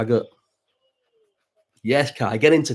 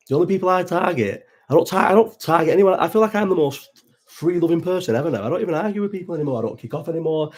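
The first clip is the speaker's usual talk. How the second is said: pitched up and insistent, protesting he's changed; indignant denial; 6 semitones higher than usual. Real, not acted. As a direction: push back hard, high and defensive. Defends himself against chat accusation.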